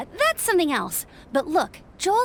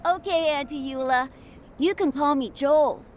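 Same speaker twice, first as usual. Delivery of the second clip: put-on young boy voice. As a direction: speak like a cheerful little boy.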